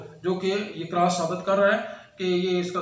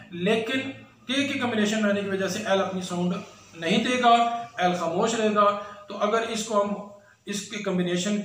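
ordinary talk, nothing marked

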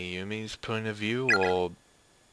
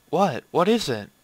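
higher, louder startled question; startled reply; 6 semitones higher, 5 dB louder than usual. Acted. Character answers someone calling out, startled and louder. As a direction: answer an urgent call, alarmed.

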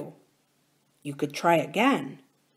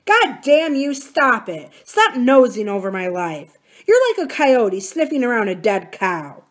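loud furious outburst; angry shouting; 4 semitones higher, 6 dB louder than usual. A narrator's reading. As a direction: lash out at the prying friend. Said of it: "Adam shouted at him".

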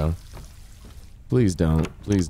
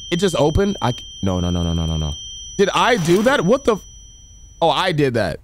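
high, panicked and breathless; scared horror game streamer; 6 semitones higher than usual. Real, not acted. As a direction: freak out at the jump scare.

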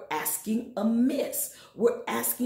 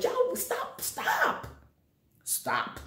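fading plea; much slower than usual. Acted. Fading repeated stop.

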